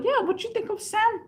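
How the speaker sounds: a playful squeaky put-on voice; mock high voice. Teasing the scammer on the phone with a squeaky put-on voice.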